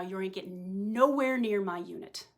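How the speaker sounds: ordinary delivery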